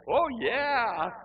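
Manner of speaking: high, loud mock-eager agreement; sarcastic enthusiasm. Reluctant visitor answering the invitation to return, with sarcastic eagerness. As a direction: overdo the eagerness so it rings false.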